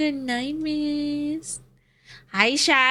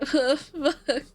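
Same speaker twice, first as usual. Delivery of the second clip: usual speaking voice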